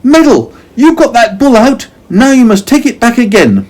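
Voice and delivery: very high, loud scolding cry; telling Meddle off in a panic; Dame Henry crying out. A narrator's reading. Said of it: "cried Dame Henry".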